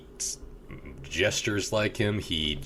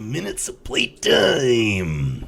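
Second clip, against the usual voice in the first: high, slow gloating taunt; wrestler trash talk; 8 semitones higher, 4 dB louder, much slower than usual. Retold or imitated. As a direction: gloat over your prey slowly. Bonesaw taunting in ring.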